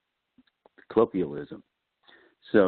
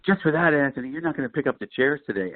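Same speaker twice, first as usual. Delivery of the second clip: higher-pitched mock scolding from a teacher; 8 semitones higher than usual. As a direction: imitate a teacher telling a pupil off. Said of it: "she scolded me".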